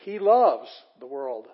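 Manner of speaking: LOVES stretched long and loud; slow, emphatic LOVES; stress on LOVES. Shown as a demonstration. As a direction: hit loves hard, then ease off on "the world". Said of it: "there's the emphasis here".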